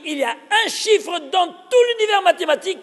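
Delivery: marveling declaration; excited proclaiming. Real, not acted. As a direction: proclaim it to the whole room. Teacher announcing a grand fact.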